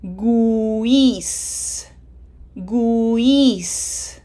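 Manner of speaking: "Guis" drawn out as goo-ees; slow syllable model of "Guis"; voiced u. Shown as a demonstration. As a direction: stretch "Guis", sounding the u before the i. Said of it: "hopefully you said voiced".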